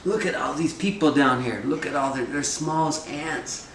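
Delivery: awed trickster looking down. Acted. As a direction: gaze down in wonder, voice lifted high.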